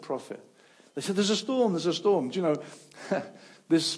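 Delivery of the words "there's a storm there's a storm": high, loud alarmed cry; alarmed shout. Retold or imitated. Sailors shouting the alarm, high and loud. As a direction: call out the danger.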